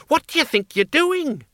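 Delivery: put-on very high, panicked old woman voice; shrill old woman. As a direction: shriek it high in alarm. Miss Flitworth shrieks.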